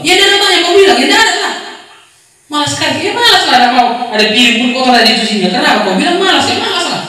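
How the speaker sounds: high, sing-song sarcastic comeback; lightly hammed-up scolding exchange; mock nagging retort. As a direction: play the lazy one sassing back. Acted quarrel, nagging and retorting.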